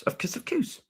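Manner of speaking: high, soft put-on voice; South Park catchphrase voice. Streamer quoting a cartoon Canadian, high soft silly voice. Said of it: "It's based on the Canadians".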